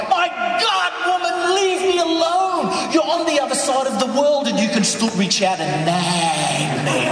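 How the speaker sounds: high, loud yelling at the phone; exasperated yell. Acted. Husband yelling at the phone at his wife, exasperated shout. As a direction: explode at the caller, stretching the words out. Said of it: "I yell at the phone".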